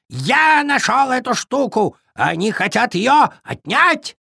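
high, indignant raven voice; cawing raven. A narrator's reading. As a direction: complain in a high squawk. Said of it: "закаркал ворон".

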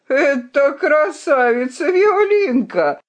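slow, booming mock laugh through the words; the knight mocking through laughter; laughing mockery. A narrator's reading. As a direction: roar at the joke. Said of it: "разразился громким смехом".

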